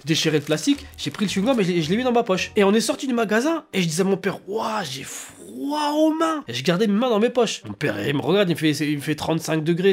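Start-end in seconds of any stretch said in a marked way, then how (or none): 4.5-6.4 high, long-drawn fake complaint; hammed-up chilly kid, softer and stretched; feigned shivering. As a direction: drag it out, badly faked cold. Kid faking cold to father.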